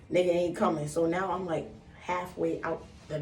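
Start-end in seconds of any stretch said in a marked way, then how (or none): none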